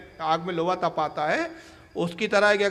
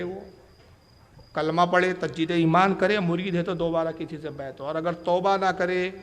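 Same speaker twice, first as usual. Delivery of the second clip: ordinary delivery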